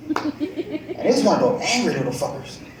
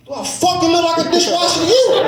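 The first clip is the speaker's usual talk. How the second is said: defiant retort; 10 semitones higher than usual. Retold or imitated. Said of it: "answered the way a man should".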